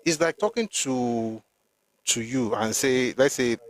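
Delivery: ordinary delivery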